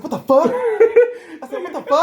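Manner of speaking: hammed-up flabbergasted yelp; shocked disbelief. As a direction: squeal it in disbelief. Stunned outrage at lost money.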